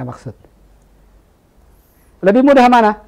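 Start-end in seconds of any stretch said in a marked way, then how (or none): none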